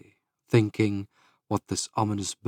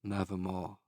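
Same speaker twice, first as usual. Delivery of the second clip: raven's reply; 2 semitones lower, 16 dB quieter than usual. A narrator's reading. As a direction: let the bird's word sink softly. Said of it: "Quoth the raven".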